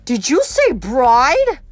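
loud, very slow, drawn-out question; incredulous demand. A narrator's reading. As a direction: stretch it out in loud disbelief. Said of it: "Clarice demanded".

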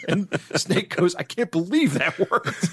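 can barely get it out for laughing. Real, not acted. Host retelling the Snake joke to his cohost, still laughing.

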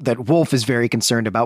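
ordinary talk

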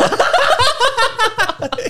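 very high cackling laugh; helpless laughter. Real, not acted. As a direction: crack up at your own joke. Cracks up at own tickle joke.

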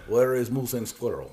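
low cartoon Russian accent; playful villain with a Russian accent; Boris. Answering the complaint at home in a mock Russian accent. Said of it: "like Boris from Rocky and Bullwinkle".

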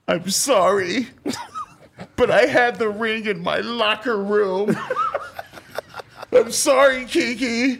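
high, teary, hammed-up sister apology; sobbing apology. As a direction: wail the sorry through laughter. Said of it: "And Asia's".

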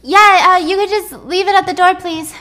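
high, loud call from inside the room; calling through door. Acted. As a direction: call out to whoever is outside, easing on "please". Guest calls through closed door.

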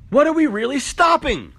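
escalating rhetorical demand. Acted. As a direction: hit the repeat harder. Repeated rhetorical question.

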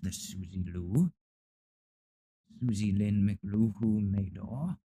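haughty cat voice. Pretend. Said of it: "She's like".

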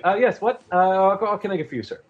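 high, quick squeaky shop voice; a tiny flustered baker greeting a customer; mouseling baker. Acted. GM voicing mouseling baker to customer, high and quick.

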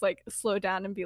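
normal talking voice

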